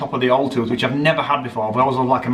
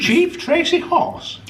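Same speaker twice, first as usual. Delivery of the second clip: surprised; much slower than usual. Real, not acted. Incredulous at an odd name.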